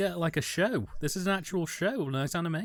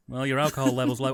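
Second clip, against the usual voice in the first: ordinary delivery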